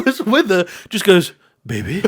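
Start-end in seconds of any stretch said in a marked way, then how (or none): 1.6-2.0 low, soft, puzzled call of her pet name; soft worried call. Retold or imitated. Boyfriend calls to his girlfriend on the floor, soft. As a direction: murmur it down at her, confused.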